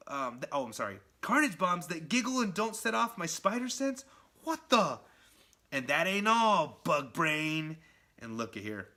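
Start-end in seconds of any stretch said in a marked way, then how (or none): 1.2-7.8 higher, louder incredulous voice; can't believe what he's seeing; startled hero. Retold or imitated. Spider-Man spotting the giggling bombs, high startled disbelief. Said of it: "He's like".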